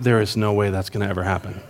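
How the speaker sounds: low, soft, quick disbelief; doubtful inner voice. Retold or imitated. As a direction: scoff to yourself that it'll never happen. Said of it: "in my mind, I went".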